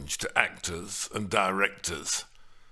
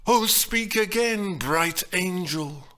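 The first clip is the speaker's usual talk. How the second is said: lovestruck Romeo; 7 semitones higher, 4 dB louder than usual. Acted. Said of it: "Romeo in the balcony scene exclaims".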